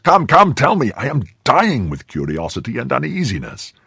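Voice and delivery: high, impatient burst, easing down; urgent pleading. Acted. Friend presses for the news, urgent pleading. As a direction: beg anxiously to be told.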